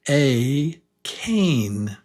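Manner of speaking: cane with a stretched "ay"; long A in cane. Shown as a demonstration. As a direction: lean on the "ay" in cane. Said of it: "The one on the right is".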